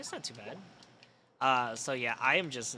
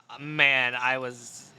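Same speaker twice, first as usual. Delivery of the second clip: ordinary delivery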